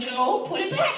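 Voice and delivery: high-pitched exasperated order; getting mad. Retold or imitated. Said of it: "I'm, like, getting mad".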